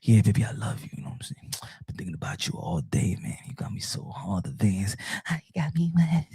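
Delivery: low, intimate pillow-talk whisper; breath-only sweet talk, put on for a lady; mock lover's whisper. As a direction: whisper seductively, hammed up. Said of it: "sometimes the ladies are like that love".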